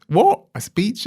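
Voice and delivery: high, loud retold shock; a startled yelp of disbelief, acted out. Head boy hearing he must give a speech, loud high shock.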